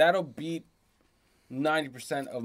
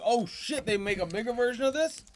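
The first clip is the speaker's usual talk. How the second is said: excited; 8 semitones higher than usual. Retold or imitated. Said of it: "I wouldn't be like".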